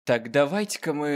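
ordinary talk, nothing marked

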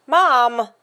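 loud, whining appeal; pleading call. A narrator's reading. Carter begging his mother to overrule the butler, loud whine. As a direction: cry out to Mom for rescue.

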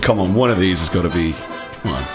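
normal talking voice

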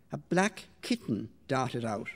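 usual speaking voice

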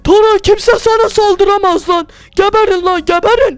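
angry grandpa shout. Acted. Grandpa keeps fighting zombies.

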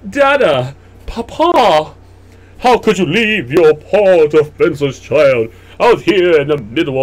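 loud, hammed-up pleading; melodramatic abandoned child. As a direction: wail to father like a wronged child in melodrama. Plays abandoned child, overdramatic lament.

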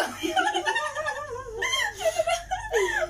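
cracking up at her own story. Real, not acted. Laughing hard while telling friends about the customer.

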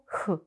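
«х» as an isolated breath sound, kh; short demonstrated «х», not a letter name; voiceless kh. Shown as a demonstration. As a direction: sound out «х» on its own. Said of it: "до глухого".

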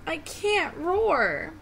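high, slow little-lion lament; mildly sniffly and defeated, drawn out; sniffling. Acted. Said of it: "sniffled Little Lion".

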